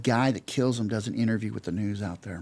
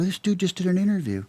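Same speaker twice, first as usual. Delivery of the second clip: high, louder disbelieving cry; like you just caught him red-handed; shocked, pitched-up exclamation; stunned realization; 9 semitones higher, 4 dB louder than usual. Retold or imitated. Spots the killer on news.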